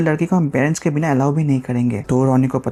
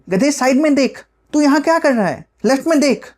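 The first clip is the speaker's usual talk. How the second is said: high-pitched scolding friend; exasperated girl; 9 semitones higher than usual. Acted. Bina tells Ronny on the phone to look left, exasperated high voice. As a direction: scold him in a high girlish voice.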